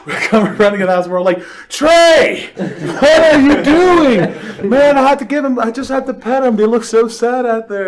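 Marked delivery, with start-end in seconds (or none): 1.7-4.7 much higher, louder drawn-out yell; mock exasperated yell. Movers catching Trey at the back door, high drawn-out yell. Catching coworker letting dogs out. 4.7-7.9 high, louder whiny excuse; imitating a coworker. Trey excusing himself for petting the dogs, high whiny voice.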